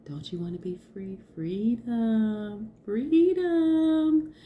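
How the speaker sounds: a bird calling you in a dream; dreamy bird call. Retold or imitated. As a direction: start hushed like a dream voice, then soar up high. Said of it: "this bird was like".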